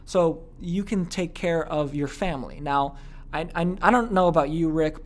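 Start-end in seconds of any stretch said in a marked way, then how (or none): 0.0-2.6 slow, caring sales promise; slow down and promise help with heartfelt concern; extreme I-care tone. Shown as a demonstration. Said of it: "that's a very extreme version of I care".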